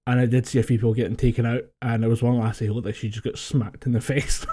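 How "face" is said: ordinary talk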